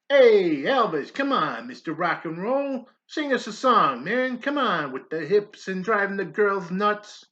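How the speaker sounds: loud jeering army buddy; taunting recruit. Acted. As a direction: egg him on, loud and mocking.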